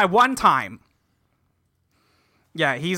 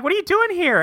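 much higher, lightly voiced startled question; high squeaky imitation of the character; high mock voice; 11 semitones higher than usual. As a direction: pitch it way up like a cartoon kid. Students voiced in recap.

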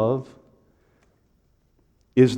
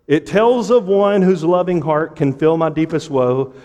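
higher, rhythmic pulpit recitation; raised preacher's cadence; impassioned hymn reading; 5 semitones higher, 5 dB louder than usual. Real, not acted. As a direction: declaim the verse in long driving lines. Reads hymn aloud to congregation.

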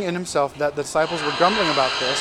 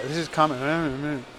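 drawn-out, slurred mock grumble; mock grumbling; much slower than usual. Preacher mimics people muttering about Jesus, grumbling. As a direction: imitate a grumbling crowd, dragging the words. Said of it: "They started grumbling".

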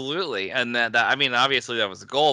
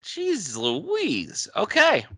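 amazed reaction; 10 semitones higher, much slower than usual. Real, not acted. Reacting to surprising crossover news.

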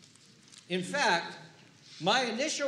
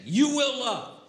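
rising, louder preaching toward the cross; impassioned preaching; 8 semitones higher, 10 dB louder, slower than usual. Real, not acted. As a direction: build the sermon to a fervent peak. Preaching builds to climax.

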